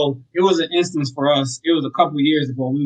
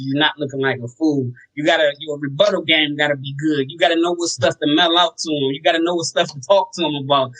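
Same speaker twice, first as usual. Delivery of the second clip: ordinary talk, nothing marked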